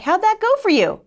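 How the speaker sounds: high, loud mock husband voice; needling husband. Husband to wife back in bed, high loud retold jibe. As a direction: call it out pointedly as the husband. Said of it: "My husband was like".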